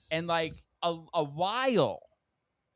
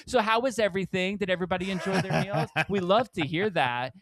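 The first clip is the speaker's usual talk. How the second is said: higher, louder fake-friendly server; sugary waiter; 5 semitones higher, 6 dB louder than usual. Waiter fishing for a tip, higher fake-friendly voice. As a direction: put on service charm. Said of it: "He's like".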